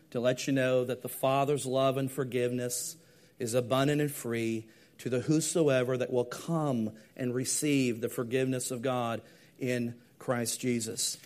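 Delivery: usual speaking voice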